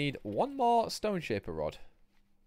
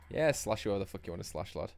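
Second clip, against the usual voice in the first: ordinary delivery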